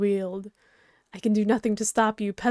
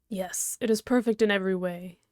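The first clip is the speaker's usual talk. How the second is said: ordinary delivery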